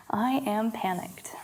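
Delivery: whispered softly and slowly; whispered affirmation. Shown as a demonstration. Teacher quietly whispers the affirmation for the class to echo. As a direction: breathe the words almost silently. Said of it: "repeat after me".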